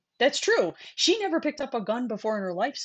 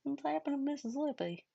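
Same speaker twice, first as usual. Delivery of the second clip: softer retake of the mock Southern line; a vague put-on Southern accent, said softer; odd mock accent; 7 semitones higher, 8 dB quieter than usual. Shown as a demonstration. Retrying the bit for her co-host, in a vague put-on Southern accent. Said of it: "It's not Southern. I don't know what the hell it is".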